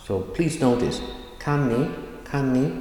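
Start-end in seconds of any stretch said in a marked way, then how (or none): 1.4-2.7 short, higher-pitched Kan ni. Shown as a demonstration. Teacher asking learners to notice unstressed words, very short. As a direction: cut Kan ni down to two quick syllables. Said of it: "Very short".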